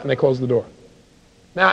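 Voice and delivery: normal talking voice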